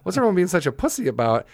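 high-pitched, incredulous jeer; mocking taunt. Retelling his taunt as friends leave.

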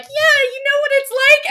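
high, bright mock-chummy Marylander; a high mock Maryland voice claiming kinship. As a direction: pitch it way up and cozy up to them. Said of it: "fucking Maryland's here, like".

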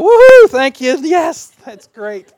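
hammed-up joyful thank-you, then settling; over-the-top cheer. Shown as a demonstration. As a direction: burst out with fake delight. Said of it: "hard for me to go".

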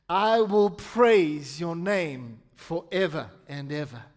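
slow psalm reading, dropping away at the end; meaningful scripture reading. Acted. Pastor reading the psalm to the church, slow and weighty. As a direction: give each phrase weight, then let it settle. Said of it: "I wanna read it with meaning".

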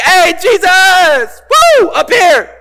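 excited yell from up a tree; screaming from the tree. Retold or imitated. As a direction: wave and holler for attention. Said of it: "Zacchaeus wasn't screaming from the tree".

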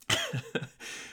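soft, high little laugh; brief quiet giggle; amused laugh under the breath; chuckle. Real, not acted. Laughs after teasing about accent.

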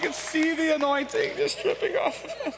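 high, loud, gushing voice of starry-eyed believers; mock churchgoers. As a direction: coo in swooning mock awe. Mocking the people in the back.

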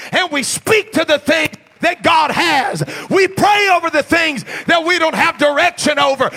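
loud, climbing sermon cadence, every phrase hammered; full-force preaching. Acted. Pastor drives the sermon home to the church, full-force preaching.

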